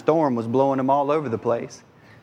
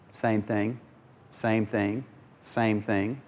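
soft repetition; 5 semitones lower, 7 dB quieter, much slower than usual. Shown as a demonstration.

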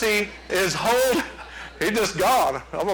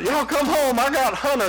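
lightly voiced frail neighbor, pitched up; fragile neighbor; 7 semitones higher than usual. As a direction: imitate a fragile neighbor calling in a higher voice. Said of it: "our neighbor is real fragile".

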